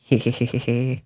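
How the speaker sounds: giddy hee-hee giggle; cartoon laugh. Real, not acted. Laughs after escaping.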